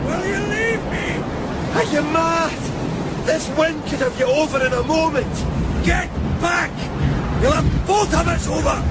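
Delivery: high urgent calls; urgent high shouting to a man at the edge; shouting over a storm. Acted. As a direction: plead and call against the gale.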